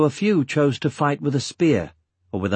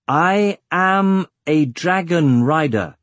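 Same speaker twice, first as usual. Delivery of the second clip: slow, higher-pitched, every word weighted; slow and emphatic; 6 semitones higher, 4 dB louder, slower than usual. A narrator's reading. Eragon to children who don't know his language, slow and emphatic. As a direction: space out and stress each word. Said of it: "speaking slowly and emphasizing the words".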